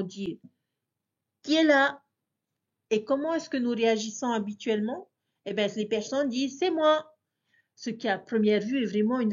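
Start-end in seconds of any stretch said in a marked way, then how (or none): 1.4-2.0 higher, louder call through a door. Acted. Calling to someone at the door. 6.6-7.1 higher-pitched answer through a door; answering a call. Acted.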